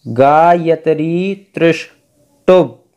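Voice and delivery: गायत्री त्रिष्टुप with the half sa in त्रिष्टुप said as sha; slow teaching recitation; precise recitation. Shown as a demonstration. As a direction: stretch गायत्री त्रिष्टुप slowly, sounding the half letter as sha. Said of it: "यहाँ पर ये आधा सा है".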